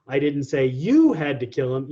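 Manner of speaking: emphasize you, rest of the sentence unstressed; stress on you. Shown as a demonstration. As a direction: hit the word you hard. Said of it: "put an emphasis on each individual word".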